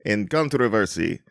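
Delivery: mock Russian bot plotting; comic Russian agent stirring up trouble; Russian accent. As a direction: scheme in a put-on Russian accent. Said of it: "It was the Russians".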